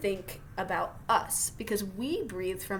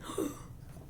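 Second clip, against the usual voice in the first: worried gasp; 10 dB quieter than usual. Real, not acted. Reacts to whale extinction.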